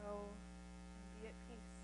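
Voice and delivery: very soft and slow, lingering on Go; preacher savoring the blessing, very soft and slow; gentle echo. Shown as a demonstration. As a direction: let Go ring softly like a bell. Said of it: "Like bells ringing softly".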